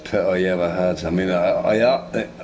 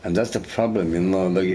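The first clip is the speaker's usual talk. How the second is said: ordinary delivery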